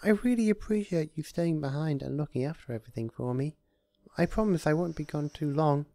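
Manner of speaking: shy high gratitude; grateful Fluttershy. A narrator's reading. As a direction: thank him gently. Fluttershy voiced.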